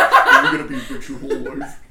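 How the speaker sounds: hidden-identity interview voice, laughing; silhouetted documentary voice. Acted. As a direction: speak like a blacked out witness. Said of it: "murder T V show. There's a silhouette".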